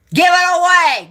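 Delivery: angry yell. Real, not acted. Yelling advice at a former viewer.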